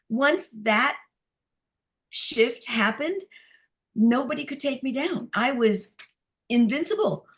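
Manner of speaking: ordinary talk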